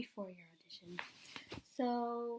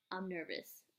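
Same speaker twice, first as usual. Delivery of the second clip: softer, understated nerves; acted nervousness; 3 semitones lower, 8 dB quieter than usual. Actor imagining the wait before an audition, mildly nervous. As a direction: quietly admit to mock jitters. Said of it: "then you just start getting nervous".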